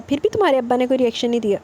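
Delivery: ordinary delivery, nothing marked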